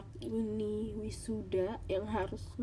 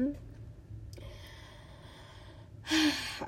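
heavy sigh; 6 semitones higher than usual. Real, not acted. Sad vlog confession.

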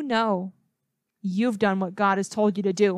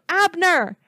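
a raised call, higher and louder; 7 semitones higher, 4 dB louder than usual. A narrator's reading. David calls to Abner across Saul's camp, raised call. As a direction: send the name across the hill. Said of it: "David called out".

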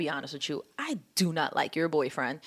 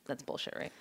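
whispered; 13 dB quieter than usual. Retold or imitated. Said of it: "And he's like".

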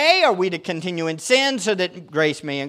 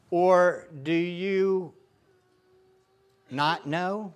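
slow reading; 4 dB quieter, much slower than usual. Acted. Reading scripture slowly.

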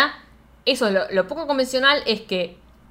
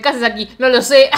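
the answer "No lo sé" said much louder; relishing the lie-detector line, louder; louder retelling; 2 semitones higher, 8 dB louder than usual. Retold or imitated. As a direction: punch "No lo sé" with glee. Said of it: "me encanta que le pregunten".

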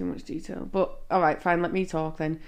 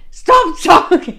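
loud, high, drawn-out "stop talking"; exasperated shout; 9 semitones higher, 11 dB louder, much slower than usual. Real, not acted. Telling partner to stop interrupting the story, exasperated shout. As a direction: stretch it out as a mock-exasperated yell.

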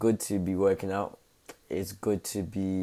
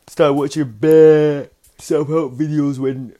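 loud, high, building excitement; worked up; 6 semitones higher, 12 dB louder than usual. Real, not acted. A vlogger getting worked up retelling the beef, loud and excited. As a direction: crank it up as the story heats.

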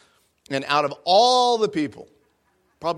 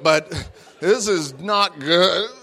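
ordinary delivery

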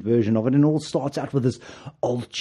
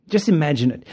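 ordinary talk

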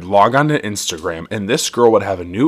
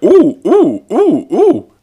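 high, loud mock-thrilled oohs; mock excited cooing; 13 semitones higher, 6 dB louder, much slower than usual. Retelling her reaction to the egg story, high mock-excited oohs. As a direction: coo like you're impressed by an egg. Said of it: "I was like".

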